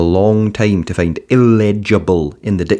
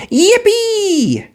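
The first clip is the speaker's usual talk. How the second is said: put-on high, delighted child's cry; excited yippee. A narrator's reading. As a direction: burst out with joy. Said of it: "cried Arthur".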